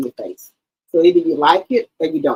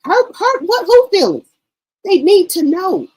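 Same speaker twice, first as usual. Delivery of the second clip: much higher pitched, rising in disbelief; high, louder pleading with mother; exasperated protest; 11 semitones higher, 3 dB louder than usual. Retold or imitated. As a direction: push back at Mama, incredulous and shrill-high. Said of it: "I'm like".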